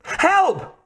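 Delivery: very high-pitched cry; shout for help. A narrator's reading. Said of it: "Kate shouted".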